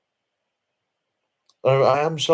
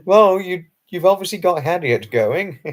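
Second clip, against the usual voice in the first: laughing; 6 semitones higher, 4 dB louder than usual. Real, not acted. Laughing reply to a chat comment.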